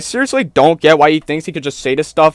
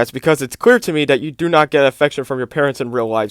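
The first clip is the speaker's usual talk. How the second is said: usual speaking voice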